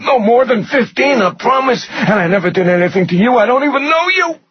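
very high, desperate screamed plea. A narrator's reading. Rudder pleading with the man hurting him, screaming. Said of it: "Rudder screamed".

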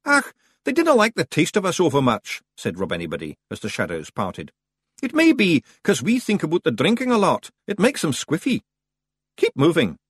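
high, loud Scots pixie; chatty wee Feegle on the run. Acted. Rob Anybody explains the Bogles while jogging.

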